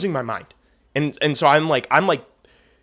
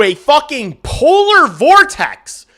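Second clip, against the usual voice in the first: high, loud, exploding rant; furious outburst; 13 semitones higher, 10 dB louder, slower than usual. Retold or imitated. Venting to viewers about the power company, furious shout. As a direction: blow up at the agent on the phone.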